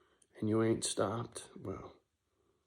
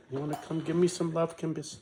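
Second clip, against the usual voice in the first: much higher, louder doting voice; 8 semitones higher, 3 dB louder, faster than usual. Real, not acted. Owner calling his dog up to him, high doting baby talk. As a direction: coo at the dog like a proud papa.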